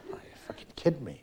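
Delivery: whispered in disbelief; patient reeling from the doctor's news, whispering; shocked whisper. Acted.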